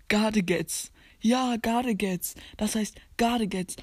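Gadgets said German-style, "GAH-de-gets"; own pronunciation of Gadgets. Shown as a demonstration. Player insists on his Gadgets, pronounced gah-de-gets. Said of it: "Alle sagen das immer falsch".

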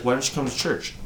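ordinary talk

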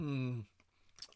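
ordinary talk, nothing marked

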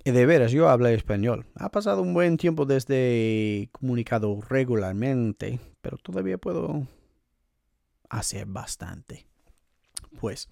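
rusty Spanish spoken quickly; Spanish accent. Shown as a demonstration. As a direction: show off some Spanish. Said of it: "my Spanish accent right there".

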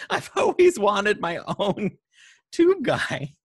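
high giddy voice; excited. Retold or imitated. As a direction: gush like a thrilled fan. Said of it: "they're like".

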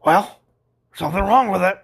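loud, pushy customer gripe; complaining older customer. Retold or imitated. Customer nags the expert to fix it, indignant.

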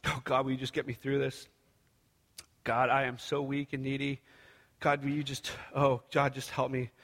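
low, hushed pleading to God; needy prayer. Retold or imitated. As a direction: imitate a weary, helpless prayer, low and soft. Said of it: "my prayers are like".

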